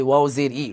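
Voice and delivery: "ই" as a bare ee, no w; common mispronunciation. Shown as a demonstration. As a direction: drop the w glide, just "ই". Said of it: "আমরা অনেকে এভাবে বলি".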